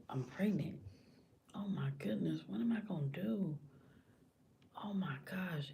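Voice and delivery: hushed, acted private shock; processing inner thought. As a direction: murmur it to yourself, almost whispering. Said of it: "she trying to process in her mind".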